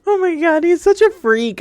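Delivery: high, loud, giddy outburst; real excited high-pitched reaction; delighted squeal. Real, not acted. Thrilled reaction to flirtatious character.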